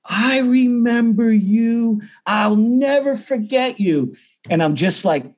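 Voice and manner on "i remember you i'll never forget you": high, slow, drawn-out exclamation; stretched-out, high mock cry of recognition; amused recognition. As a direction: imitate someone crowing with delighted recognition. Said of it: "he starts laughing. He goes".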